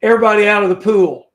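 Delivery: loud, fast call-out. Retold or imitated. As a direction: bellow it like a lifeguard. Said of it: "I use a phrase".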